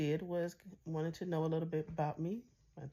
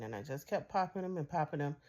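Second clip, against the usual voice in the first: usual speaking voice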